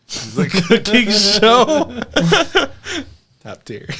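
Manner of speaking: laughing through the words. Real, not acted. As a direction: giggle it out. Laughing while naming show.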